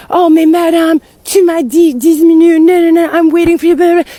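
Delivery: loud, high imitation of an irate rant; hammed-up shouting over the phone; mock yelling. Installer berating a customer for being late, mock yelling. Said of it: "Starts yelling at me".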